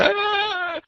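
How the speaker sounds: very high mock panic yelp; mock shriek of a panicking leader; panicked shriek. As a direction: squeal like a nervous wreck. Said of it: "chewing on your fingernails going".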